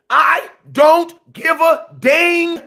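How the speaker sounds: "I don't give a dang" high, slow, spaced out; emphatic word-by-word. Acted. Fan states new rule, "I don't give a dang" slowly punched out.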